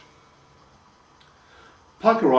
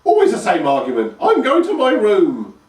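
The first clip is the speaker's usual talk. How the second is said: put-on huffy old crone; 10 semitones higher, 8 dB louder than usual. A narrator's reading. Grandma sulking at the same old argument. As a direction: huff it off indignantly.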